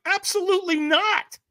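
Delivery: high emphatic mock refusal; flat refusal. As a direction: refuse outright. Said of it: "And I'm like".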